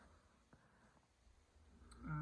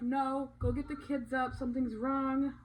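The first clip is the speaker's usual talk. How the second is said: louder, higher retold urgent command; urgent order; 4 semitones higher, 9 dB louder than usual. Wife retelling telling husband to get the kids, urgent.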